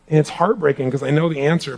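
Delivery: normal talking voice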